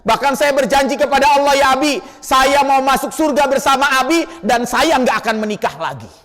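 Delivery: high, pleading voice of a grieving wife; tearful promise. Acted. Wife promising her dying husband, high and pleading.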